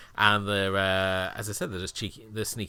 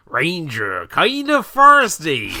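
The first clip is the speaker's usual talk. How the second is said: slow, high, louder Cornish fantasy villager; Cornish accent; 8 semitones higher, 12 dB louder, much slower than usual. Retold or imitated. Fable villager greeting the player in a Cornish accent. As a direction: drawl it in a Cornish accent, climbing up. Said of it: "a lot of Cornish accents".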